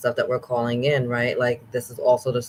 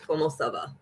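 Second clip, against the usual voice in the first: French phrase; 3 semitones higher, 5 dB quieter than usual. Real, not acted. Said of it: "I don't speak a lot of French".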